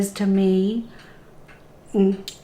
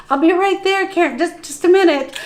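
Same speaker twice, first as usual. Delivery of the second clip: much higher, bright, falsely calm call through the door; panicked forced cheer; 8 semitones higher, faster than usual. Retold or imitated. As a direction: sound breezy while panicking inside. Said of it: "I was like".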